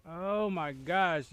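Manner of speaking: slow, loud high oh my gosh; shocked. Real, not acted. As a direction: stretch it out in dismay. Character dying in game.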